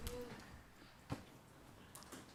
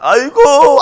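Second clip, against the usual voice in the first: mock groan; 12 semitones higher, 5 dB louder, much slower than usual. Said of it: "저희의 마음 있잖아요".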